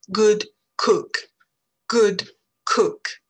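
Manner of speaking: good cook with a short relaxed oo, jaw forward; English short oo. Shown as a demonstration. Teacher showing French learners the short oo in good cook. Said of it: "quand on va prononcer good cook".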